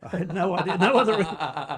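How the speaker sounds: laughing loud and high; bursting with laughter. Real, not acted. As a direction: laugh through the words. Laughing retelling.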